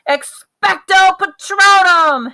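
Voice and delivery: high, loud, slow bellowed incantation; bellowed spell. A narrator's reading. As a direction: bellow it with all your strength. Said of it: "Harry bellowed".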